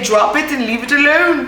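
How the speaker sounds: high, put-on British-ish accent; quoting Shrek, slipping into British; Shrek quote in accidental accent. Vlogger quoting Shrek in an accidental British accent. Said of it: "I don't know where the accent came from".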